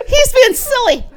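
loud, high laughter through the words; can barely get it out for laughing; laughing hard. Real, not acted. Real laughing fit at joke.

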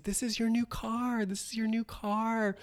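high, soft gushing mom voice; gushing mother. Retold or imitated. Mother presents a new car to her daughter, high and gushing. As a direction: coo it like a proud showy mother. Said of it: "and Vicky's like".

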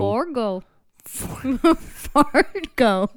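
badly faked Minnesotan "Fargo"; bad Minnesotan accent. Co-host attempting to copy the host, bad Minnesotan accent. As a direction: overdo a clumsy Minnesotan "Fargo". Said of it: "You're the worst at accents".

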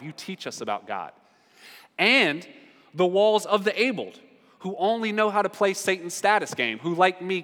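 ordinary delivery

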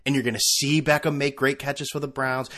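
ordinary talk, nothing marked

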